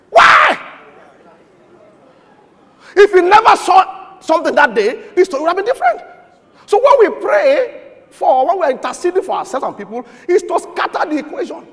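loud, high preaching burst; preacher shouting a rebuke, then dropping. Acted. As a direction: thunder it at the congregation. Preaching at full force.

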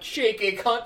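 British mock yell. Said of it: "I can just yell out".

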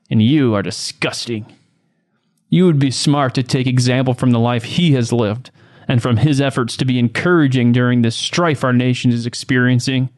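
ordinary talk, nothing marked

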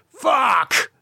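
high sharp yelp of pain; pained cry. A narrator's reading. Lee landing hard at the foot of the stairs, pained cry. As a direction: cry out as you hit the floor. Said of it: "he cried as he landed".

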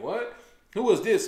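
retelling his own startled reaction; surprised. Retold or imitated. Recalling when his partner praised a newcomer, surprised. Hearing about the new comic.

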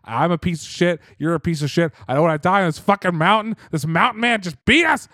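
hammed-up furious villain rant; retold rant. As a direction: spit it out like a cornered crook losing it. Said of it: "he's like".

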